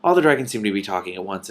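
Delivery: ordinary delivery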